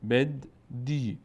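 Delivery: بد، دی said slowly with a long ee on دی; teacher breaking بدی into بد and a drawn-out دی; stretched syllables. Shown as a demonstration. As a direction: drag out بد then a long دی.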